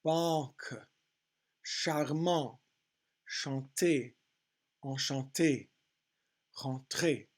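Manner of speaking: "Banque, charmant, chanter, enchanté, rentrer" word by word, French nasal AN; careful French teacher's word list; French pronunciation demo. Shown as a demonstration. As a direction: model each French word separately with a nasal AN. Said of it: "look at the syllabification".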